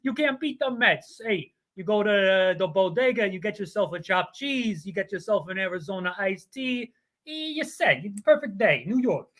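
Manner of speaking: hammed-up Brooklyn accent, loud at first; a brash New Yorker bragging about the Mets in a Brooklyn accent. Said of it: "they say it's a Brooklyn accent".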